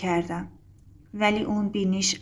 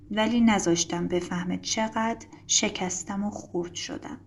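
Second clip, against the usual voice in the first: plain reading voice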